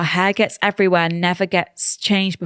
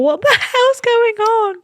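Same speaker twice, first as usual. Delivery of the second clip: disbelieving; 12 semitones higher, slower than usual. Retold or imitated. Voicing disbelief at ex.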